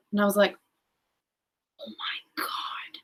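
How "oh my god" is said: slow, hushed whisper of amazement; awed whisper. Retold or imitated. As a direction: whisper it slowly, stunned by the omen. Retelling awe at seeing the sign.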